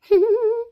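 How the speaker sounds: high, loud triumphant laugh; gloating laugh. Acted. Sister-in-law alone after dodging the chores, gloating laugh. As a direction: laugh like a schemer who got away with it.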